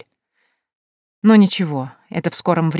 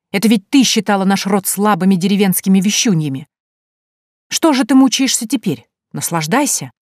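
louder, rising scolding; exasperated mother's accusations; heated reproach; 3 semitones higher, 5 dB louder than usual. A narrator's reading. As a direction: scold your grown daughter with mounting force. Мать ссорится с дочерью.

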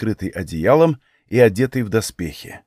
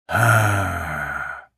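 low, drawn-out sleepy sigh of sound; lazy drawn-out aaah; long weary exhale; 5 semitones lower, 3 dB quieter than usual. Acted. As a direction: breathe it out long and tired. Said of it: "выдохнул я".

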